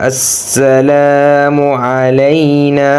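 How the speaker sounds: "السلام علينا" chanted with long "لا"; one-alif elongation. Shown as a demonstration. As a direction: stretch the aa in "السلام". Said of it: "এক আলিফ টান হবে".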